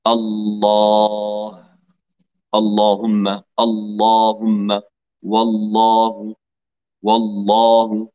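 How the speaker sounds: الله with a thick velarized lam, slow and drawn; heavy lam. Shown as a demonstration. Teacher leading the class in slow emphatic lam. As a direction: pronounce الله, اللهم, والله with a full heavy l. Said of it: "اللام المفخمة قولوا معي".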